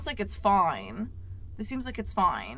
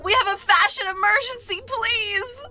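dramatic fake emergency call; 9 semitones higher than usual. Pretend emergency phone call.

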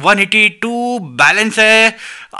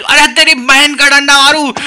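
startled outburst; 7 semitones higher than usual. Real, not acted. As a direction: jolt up in alarm. Startled outburst during game.

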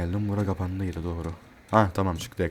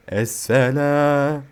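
mock greeting; 6 semitones higher, 6 dB louder, much slower than usual. Greeting game characters mockingly.

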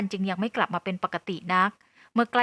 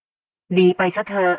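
slow, firm dismissal; louder, measured send-off from a mother; 7 dB louder, much slower than usual. A narrator's reading. Mother tells Nee to leave. (เสียงแม่หนักแน่น)

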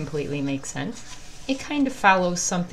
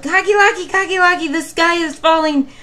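yelled; 11 semitones higher, 11 dB louder than usual. Acted. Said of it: "and yelled".

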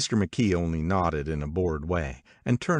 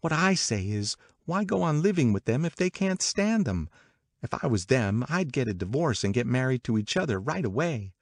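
high, lively gossiping woman; opinionated Catherine; 7 semitones higher than usual. Acted. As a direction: give the opinion in a high, insistent voice. Voices Catherine giving her opinion.

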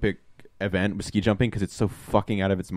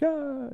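high, strained gasp of joy; high mock cheer; 7 dB quieter than usual. Eddie standing up at the closing night, high gasp. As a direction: squeak out a thrilled ah. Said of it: "he stands up. He's like".

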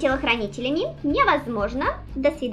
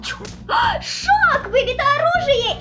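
high excited yelp, louder; 10 semitones higher, 3 dB louder, slower than usual. Real, not acted. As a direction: yelp it out in glee. Hit lands in fight.

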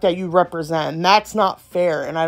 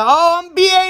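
mocking brag; 9 semitones higher, 4 dB louder than usual. Mocking others bragging.

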